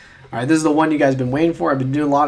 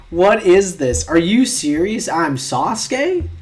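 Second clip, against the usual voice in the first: loud, high, incredulous protest; outraged disbelief; 4 semitones higher, 6 dB louder, slower than usual. Real, not acted. Reacting to unwanted quiz result, loud outraged disbelief. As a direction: blurt it in shock, rising at the end.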